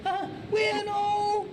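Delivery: exaggerated, very high Scouse voice; high Liverpool whoop running into drawn-out words; Scouser accent. Shown as a demonstration. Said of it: "So here's, I think, a Scouser".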